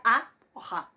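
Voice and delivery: "a" plain, then "ja" aspirated; pronunciation demo. Shown as a demonstration. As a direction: sound out "a o ja", h only on "ja". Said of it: "Eso se pronuncia".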